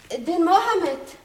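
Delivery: hoch und laut durchs Haus nach dem Diener rufen; laut gerufen. Acted. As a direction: ein lauter Ruf in den Nebenraum. (die Marschallin ruft laut nach dem kleinen Diener)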